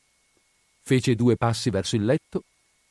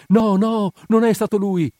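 much higher, louder pleading cry; desperate scream; 11 semitones higher, 6 dB louder than usual. A narrator's reading. Redhead screaming to defend the young man, desperate scream. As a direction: scream to defend him, arms outstretched. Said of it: "l'ercolano urlava con le braccia tese".

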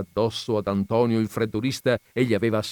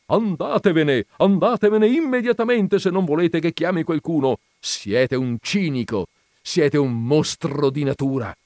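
exasperated shouting; 8 semitones higher, 6 dB louder than usual. A narrator's reading. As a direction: drive him away in outrage. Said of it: "gridò la vedova esasperata". (urla esasperate)

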